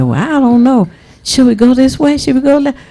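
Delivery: lost, clueless Bubba. Acted. Bubba unsure where to go in the Capitol, comic bewilderment. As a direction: pitch climbing as he dithers.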